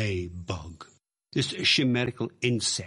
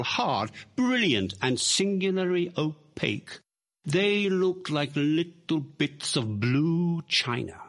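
plain reading voice

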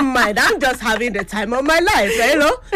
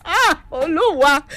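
usual speaking voice

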